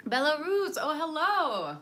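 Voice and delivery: excited hello to a viewer from Belarus; excited greeting. Real, not acted. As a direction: call out happily to a new viewer.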